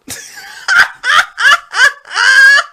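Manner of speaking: shrill mocking cackle; high cackling laugh. Real, not acted. Host cackling at the losing guest. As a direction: cackle at his loss.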